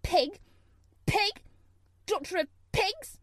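high, loud spiteful name-calling; angry child's insults. Acted. Girl cursing her absent ayah, loud and spiteful. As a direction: spit the insults out like a furious little girl. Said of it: "muttering to herself the things she would say".

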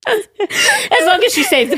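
real laugh breaking into the words. Real, not acted. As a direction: joke while cracking up. Laughing at friend's fall story.

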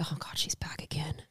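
whispered aside; whispering. Retold or imitated. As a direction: mutter it under your breath. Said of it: "They're like".

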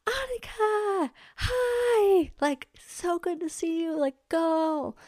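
high, bubbly mock cheer; cheery friend shouting. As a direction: imitate an overexcited friend cheering you on. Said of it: "she shouts to me".

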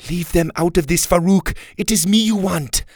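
loud high defiance; defiant. A narrator's reading. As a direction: stand up to him firmly. Gabriella defiant.

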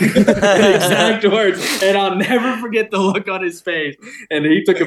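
real laughter at grandpa's words; laughing. Real, not acted. Laughing recalling grandpa's reaction.

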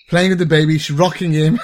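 ordinary talk

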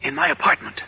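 hushed dread. Acted. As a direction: whisper the danger to a friend. Tense hushed dialogue.

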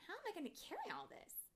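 high, quick puzzled question; wondering. A narrator's reading. Tink wonders how to carry all her supplies, wondering. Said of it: "Tink wondered".

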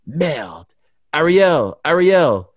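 excited French-Canadian GSP voice; imitating GSP. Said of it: "So he kept saying".

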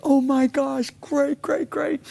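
high-pitched cheering at the TV; retold excited cheering. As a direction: cheer the Cowboys on, voice jumping way up. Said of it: "I'm screaming".

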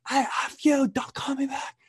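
hammed-up grandma cussing down the phone; swearing old grandma. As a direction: impersonate a cranky old lady cursing out a caller. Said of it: "eighty-year-old grandma on the phone, and she's swearing like a sailor".

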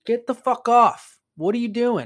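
loud, high-pitched exasperated shout; exasperated order. Retold or imitated. Painter orders drunk woman off his lift, loud exasperated retelling. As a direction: yell it in disbelief, retelling the moment.

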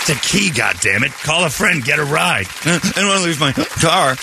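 a hint of an annoyed father; exasperated dad.

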